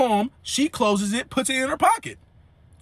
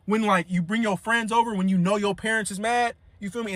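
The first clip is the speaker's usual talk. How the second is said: ordinary talk, nothing marked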